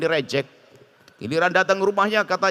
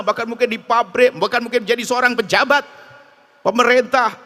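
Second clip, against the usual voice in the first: louder, higher, driving sermon delivery; fiery preaching; 6 semitones higher, 5 dB louder than usual. Acted. Preacher urging the congregation about true saints, full-force preaching. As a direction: preach it hard to the crowd.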